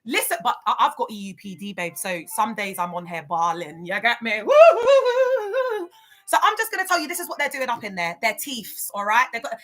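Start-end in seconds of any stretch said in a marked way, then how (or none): 4.4-5.9 high loud woo cheer; joyful high whoops, much higher; whooping. Real, not acted. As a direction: whoop like at a party. Cheering after laugh.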